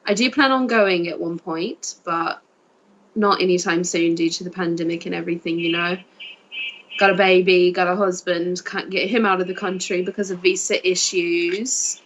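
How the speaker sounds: put-on southern English accent. Shown as a demonstration. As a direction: talk as if from Sussex or Essex. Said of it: "you had that accent".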